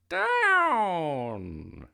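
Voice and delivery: loud, high witch's cry; storybook witch calling a loud command. A narrator's reading. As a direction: stretch it into a falling shout. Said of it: "cried the witch".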